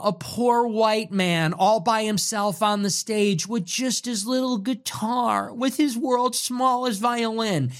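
lightly hammed-up weepy whine; mock pity. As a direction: sneer like a crybaby's pity party. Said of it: "sounding like he's gonna cry".

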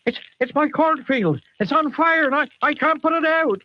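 high, fretful West Country yokel; West Country farmer. Acted. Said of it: "why is this farmer clearly from the Southwest?".

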